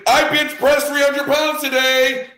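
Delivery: hammed-up bragging, way up and loud; boastful brag. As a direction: announce it proudly like a self-absorbed mourner. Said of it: "and you say".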